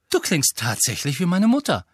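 loud, high-pitched accusation; flabbergasted reproach. A narrator's reading. Ron compares Hermine to his mother, appalled. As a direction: blurt it out, appalled.

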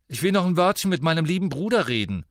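fast, high, loud menace; irate. A narrator's reading. Sister wants a word with her brother, irate.

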